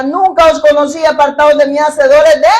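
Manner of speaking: loud, stern pronouncement from above; the Lord rejecting false followers, loud and stern; stern declaration. Retold or imitated. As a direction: condemn them with full authority. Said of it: "le declarará".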